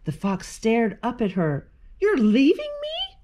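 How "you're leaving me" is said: high-pitched incredulous question; dismayed disbelief. A narrator's reading. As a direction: squeal it in wounded disbelief. Abandoned fox pleads with Brownie.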